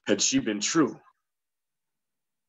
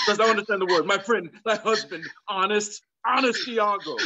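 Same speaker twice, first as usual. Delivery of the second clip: exasperated; 7 semitones higher than usual. Acted. Othello insists, exasperated.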